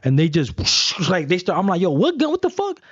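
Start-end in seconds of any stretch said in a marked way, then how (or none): 1.8-2.8 high, startled protest; high-pitched retold outrage; shocked disbelief. Retelling his shock at the cops.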